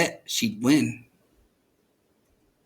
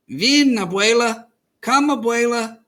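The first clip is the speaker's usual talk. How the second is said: higher, eager call; child calling; 7 semitones higher, 4 dB louder than usual. A narrator's reading. Girl calling abuela to come fly, raised and eager. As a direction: call her up to fly with you.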